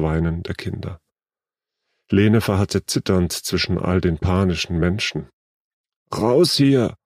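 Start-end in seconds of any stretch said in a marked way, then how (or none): 6.1-7.0 higher-pitched urgent cry; urgent shout. A narrator's reading. Older girl pulls Lene from the train, urgent shout. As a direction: shout an order to get out, mildly. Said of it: "schrie Elisabeth".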